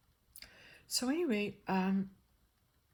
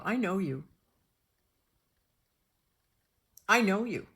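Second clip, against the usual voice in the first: intense I know you; 8 dB louder than usual. Retold or imitated. Eerie recognition of a doctor.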